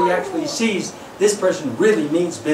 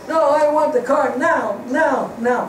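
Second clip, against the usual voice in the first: put-on higher, insistent kid whine; demanding child; 6 semitones higher than usual. Child insisting to his father on the car now, higher. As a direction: push it like a spoiled kid. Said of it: "the kid shouting".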